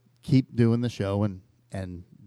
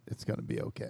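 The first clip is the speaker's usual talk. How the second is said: whispering; 13 dB quieter than usual. Pretend. Soothing the worried boys.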